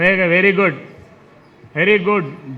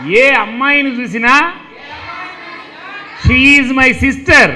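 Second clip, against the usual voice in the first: slow, measured oath recital; teacher leading children in a slow pledge; 2 semitones higher than usual. Acted.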